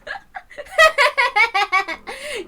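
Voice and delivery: a long, high falling cackle; triumphant cackle. Acted. Schemer after springing her trap, long cackle. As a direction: cackle over the fallen victim. Villain laughing at victim.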